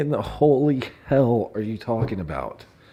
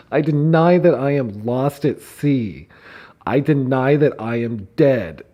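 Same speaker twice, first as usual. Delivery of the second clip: louder, higher, laughter breaking in; loud laughing read; 5 semitones higher, 6 dB louder than usual. Real, not acted. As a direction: declaim it and crack up. Loud reading, cracking up.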